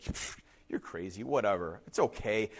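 low, very soft dismissal; laid-back castaway, quiet and low; unbothered brush-off. Retold or imitated. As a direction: shrug it off under the voice. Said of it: "The other man just like".

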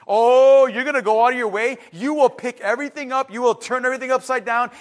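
big loud "oh", then urgent rush; high, emphatic, hurried intensity. Shown as a demonstration. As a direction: build it up like a frantic search. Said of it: "it gets louder and more frantic".

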